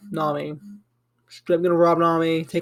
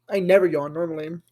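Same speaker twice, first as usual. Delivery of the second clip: ordinary talk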